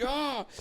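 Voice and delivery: high mock grunt of someone failing a lift; strained lifting yell. Imitating a buddy struggling to max on bench, strained yell. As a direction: yelp like you can't get the bar up.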